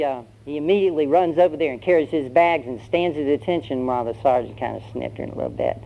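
ordinary talk, nothing marked

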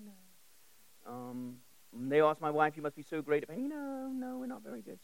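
normal talking voice

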